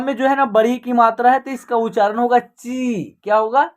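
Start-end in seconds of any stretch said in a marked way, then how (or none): 2.4-3.2 ची with a long, held ee; long vowel. Shown as a demonstration. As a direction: stretch the ī in ची. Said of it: "खींच करके करना होगा".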